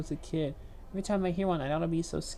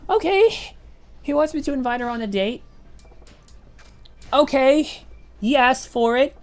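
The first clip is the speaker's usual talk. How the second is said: loud resignation; 8 semitones higher, 9 dB louder than usual. Real, not acted. Gives in to game prompt.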